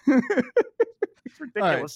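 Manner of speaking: ordinary talk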